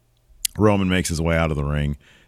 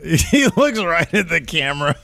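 amused, higher-pitched through a laugh; 8 semitones higher than usual. Real, not acted. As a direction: laugh as you recall the funny moment. Laughing at a funny TV moment.

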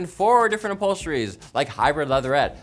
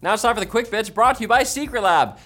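ordinary talk, nothing marked

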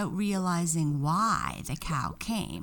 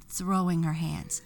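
ordinary delivery

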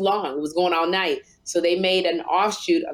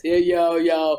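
mock impression dropping lower; Kim Whitley; 3 semitones lower than usual. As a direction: imitate a friend's cartoon voice, sliding down low. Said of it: "that's the way you talk".

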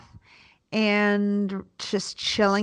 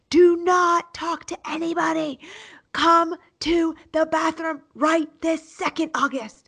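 higher, stern urgent order; mother barking at her child; screaming; 8 semitones higher than usual. Retold or imitated. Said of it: "I start screaming".

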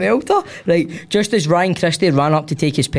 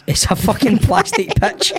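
much higher indignant heckle; mock shout back; 9 semitones higher than usual. Lad answering the older gentleman at the game, mock shout. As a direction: imitate a lad shouting back, pitch jumping up. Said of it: "A lad shouted back".

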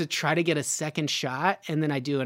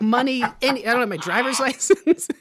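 ordinary delivery

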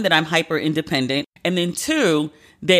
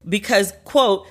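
usual speaking voice

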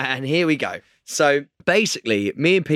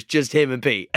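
ordinary talk

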